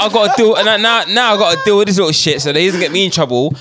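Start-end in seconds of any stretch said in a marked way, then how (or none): 0.0-2.4 put-on tired mum in a West African accent; exasperated mother, heavy West African accent. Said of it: "she's like".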